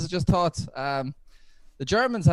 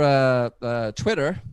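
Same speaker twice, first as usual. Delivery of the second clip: ordinary delivery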